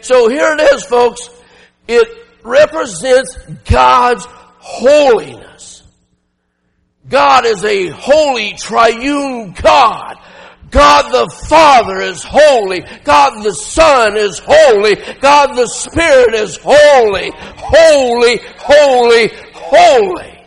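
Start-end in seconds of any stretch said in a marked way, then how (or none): 1.9-17.3 slow, loud and deliberate proclamation; ringing slow preaching cry; slow proclaiming shout. Acted. Preacher proclaims God's holiness. 17.3-20.4 shouted holy. Acted. Preacher repeats the triple cry.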